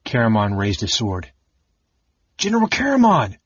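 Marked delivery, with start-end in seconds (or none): 2.4-3.4 much higher, loud call from outside; alarmed shout. A narrator's reading. Soldiers outside calling their general, alarmed shout. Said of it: "Alarmed voices shouted outside".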